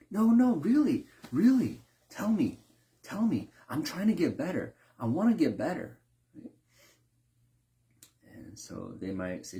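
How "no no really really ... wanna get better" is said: high, loud, begging for honest feedback; high, urgent plea to improve; eager pleading. Retold or imitated. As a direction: plead to be told what's wrong like an eager cook. Cook begging for criticism.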